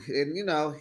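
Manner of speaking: normal talking voice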